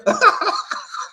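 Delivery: squealing, very high laughter; high cackle. Real, not acted. Host cracking up over the lost case, squealing laugh. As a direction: let out a helpless high cackle.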